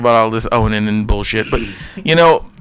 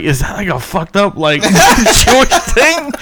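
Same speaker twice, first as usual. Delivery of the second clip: laughing question; 7 semitones higher, slower than usual. Retold or imitated. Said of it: "I was like".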